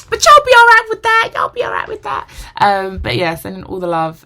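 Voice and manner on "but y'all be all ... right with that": much higher, loud teasing tone; playful squeal. Real, not acted. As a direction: sing it up high like a tease. Playful high teasing to viewers.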